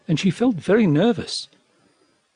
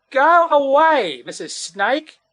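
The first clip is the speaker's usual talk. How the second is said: high, drawn-out cry of "go away"; frightened mother calling out; loud call; 9 semitones higher, slower than usual. A narrator's reading. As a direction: call out high to drive someone off. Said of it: "she called in a loud voice".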